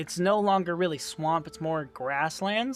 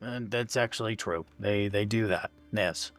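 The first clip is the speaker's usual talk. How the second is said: sheepish agreement; 7 semitones lower, 4 dB quieter than usual. Acted. DM voices smith agreeing sheepishly.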